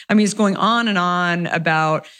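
usual speaking voice

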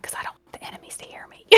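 fast whisper; quick secretive whisper. Retold or imitated. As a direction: hush it so the enemies can't hear. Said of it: "I start whispering".

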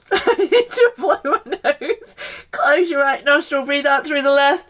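high, giggly, words breaking into laughter; laughing. Real, not acted. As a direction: laugh through the instructions. Laughing at own squeaky nose.

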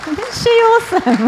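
high, loud, slow gushing praise; rally the room behind her; real delighted hype for Tanya; excited cheer. Real, not acted. Host cheering the speaker.

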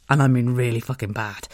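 ordinary talk, nothing marked

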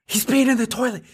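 high, thrilled cheering; excited high squeal. Retold or imitated. Parent cheering son peeing in toilet, high excited squeal. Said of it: "And I'm like".

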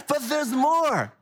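slow, high, hyped TV pitch; infomercial voice. Acted. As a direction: sell it like a late-night ad. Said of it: "I sound like an infomercial".

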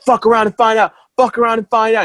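mock yell, high but not full volume; retold screaming. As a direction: act out his taunting shout, held back. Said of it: "he's screaming at the guys like".

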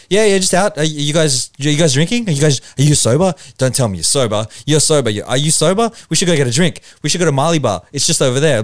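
high, insistent mock shouting; shouting stranger. Stranger offloading on brothers after lockdown, shouting. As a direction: imitate a pushy stranger firing questions loudly. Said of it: "was just shouting at us".

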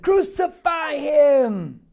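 long, rising-then-falling yell; crowd shouting. Acted. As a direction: shout it like a crowd demanding blood. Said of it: "They all said".

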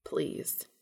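whispered, urgent please; a gasped whisper of pleading; breathless plea. A narrator's reading. Cass gasped.

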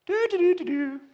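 light, high skipping hum; merry whistle-hum. Retold or imitated. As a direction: hum it like skipping along happily. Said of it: "with a skip and a whistle".

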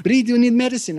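put-on high, fussing Argentine Spanish-accented voice; a caring Argentine colleague fussing, high and accented; mimicking her Argentine accent. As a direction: imitate the eager coworker with her Argentine accent. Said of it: "because of her accent".

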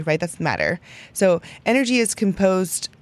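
normal talking voice

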